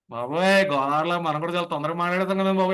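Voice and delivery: ordinary talk, nothing marked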